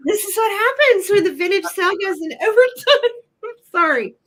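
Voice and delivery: laughing. Real, not acted. Laughing at sale overtime.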